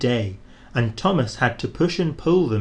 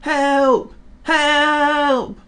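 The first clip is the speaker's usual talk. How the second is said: high, louder cries for help; a frightened engine shrieking from afar; calling for help; 5 dB louder than usual. A narrator's reading. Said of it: "he heard an engine whistling".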